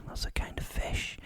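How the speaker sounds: a quick hushed whisper to the listener; whispered aside. A narrator's reading. Narrator whispering an aside to the listener. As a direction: drop to a breathy whisper, an aside.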